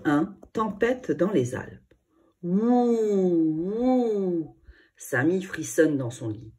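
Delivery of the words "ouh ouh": long wolf-like ooh, ooh; howling wind. A narrator's reading. The storm howling outside the chalet, wolf-like howl.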